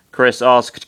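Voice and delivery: usual speaking voice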